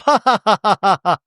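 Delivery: high, loud fake society laugh; hammed-up posh laugh. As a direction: trill out a grand mock laugh.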